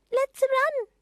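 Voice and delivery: put-on high, loud girl's cry; a girl ready to dash off; eager girl Bobby. A narrator's reading. Bobby urging the others to run, high and eager.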